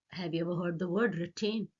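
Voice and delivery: ordinary delivery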